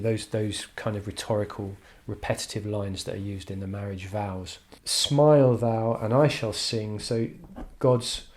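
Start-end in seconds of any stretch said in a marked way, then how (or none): 4.8-7.0 reading poetry aloud. Shown as a demonstration. Reading poem line.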